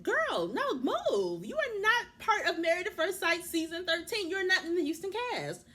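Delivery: high-pitched, emphatic telling-off; indignant outburst. Real, not acted. Vlogger shooing the producer off screen, indignant. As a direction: order them out, voice way up.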